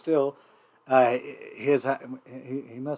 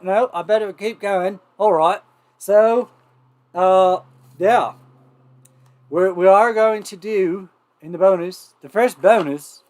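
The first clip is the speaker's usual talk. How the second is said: raised, loud mock-Australian; horrible Australian accent; 4 semitones higher, 5 dB louder than usual. Host carrying on announcements in a bad Australian accent, pitched up. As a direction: keep a wobbly Australian accent going. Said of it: "The accent just got horrible".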